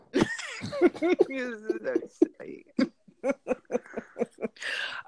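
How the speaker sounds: a long, loud, high cackle; long loud laugh. Real, not acted. Host cackling at "I elude people", long loud laugh.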